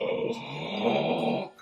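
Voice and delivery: long, low voiceless breathy "oh"; imitated creature whoosh. Host imitating a bat wing folding over a man, breathy whoosh. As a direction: exhale a soft engulfing whoosh. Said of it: "you get, like, the, like".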